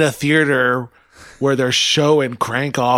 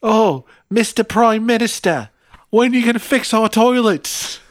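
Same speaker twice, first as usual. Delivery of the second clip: put-on Australian accent; 8 semitones higher than usual. Aussie pleading with the Prime Minister, Australian accent. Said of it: "What if you were from Australia?".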